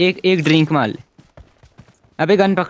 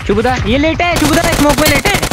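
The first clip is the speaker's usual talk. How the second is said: excited callout; 11 semitones higher, 5 dB louder than usual. Real, not acted. Calling enemy position to teammate.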